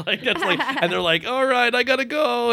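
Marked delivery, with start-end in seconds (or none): 1.3-2.5 put-on a boy's voice, pitched way up; squeaky teen rushing off; high kid voice. Voicing the kid in the show.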